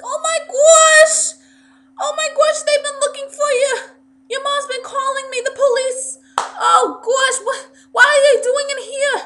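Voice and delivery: shrieking New York mom, very high and loud; New York accent. Retold or imitated. Mom discovering the runaway in the bathroom, shrieked New York accent. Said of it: "she has a New York accent".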